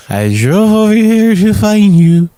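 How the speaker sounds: high, loud, drawn-out mock wail; hammed-up tearful drunk; put-on drunk act. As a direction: wail it slowly like a fake sad drunk. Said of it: "I just started the act".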